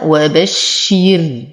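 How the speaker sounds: وبشر stopped on a thin sukun ra; pausing recitation. Shown as a demonstration. Teacher shows how to pause on the word. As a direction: end on وبشر with a light, thin ra. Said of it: "ولو وقفت عليها".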